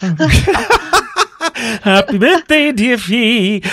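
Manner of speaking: birthday wish broken by a laugh. Real, not acted. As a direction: laugh through the greeting. Host laughs mid-greeting.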